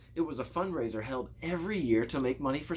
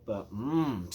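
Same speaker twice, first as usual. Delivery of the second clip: ordinary delivery, nothing marked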